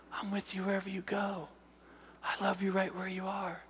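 very soft, tender whisper; intimate, comforting hush; gentle divine whisper. Retold or imitated. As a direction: whisper loving reassurance, unhurried. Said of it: "I'm gonna whisper to him".

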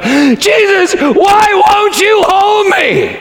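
high, slow anguished shout; crying out. Retold or imitated. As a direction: cry out to Jesus in desperation. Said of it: "she just cried out".

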